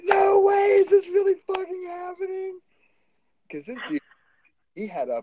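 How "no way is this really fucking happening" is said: much higher, loud disbelieving shout; freaking out. Retold or imitated. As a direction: yell in shocked excitement. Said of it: "I start freaking out".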